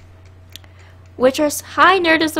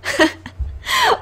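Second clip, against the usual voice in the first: shrieking laugh. Real, not acted. Shocked at winning own raffle.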